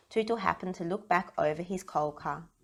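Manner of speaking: ordinary delivery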